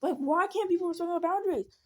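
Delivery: high, frustrated growl into a complaint; retold angry outburst. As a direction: groan loudly, then protest at a high pitch. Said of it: "I was just very angry".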